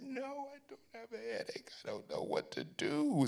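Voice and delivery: high, strained mock despair; acted helpless protest; retold exasperation. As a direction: plead it in a high, thin voice. Retold exasperated answer to therapist.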